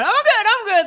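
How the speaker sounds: high strained squeak of a drowning person; panicked drowning swimmer. Retold or imitated. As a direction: pretend all is fine while going under. Drowning swimmer insisting fine.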